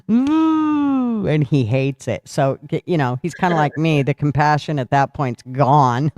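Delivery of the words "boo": high, whiny pouting boo; a hint of a weepy drunk; mock pout. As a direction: sulk it out. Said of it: "I'm gonna be like".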